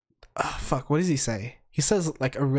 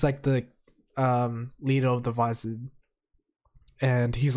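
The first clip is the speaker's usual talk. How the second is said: ordinary delivery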